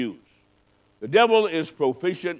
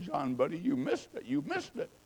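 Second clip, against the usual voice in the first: a much softer, tearful plea; choked up, softer; 2 semitones lower, 13 dB quieter than usual. Retold or imitated. Preacher choking up as he pleads with the crippled man, soft and tearful. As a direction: tell him gently through tears. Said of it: "I started crying".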